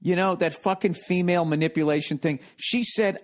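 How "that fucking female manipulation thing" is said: ordinary delivery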